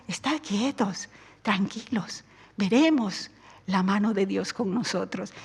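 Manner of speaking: soft, calm reassurance; quietly steady the crowd. Acted. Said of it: "con un tono tranquilo".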